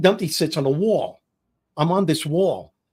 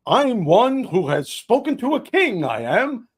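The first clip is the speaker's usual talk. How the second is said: overenthusiastic line; 6 semitones higher than usual. Retold or imitated. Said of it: "said it with a little bit too much enthusiasm".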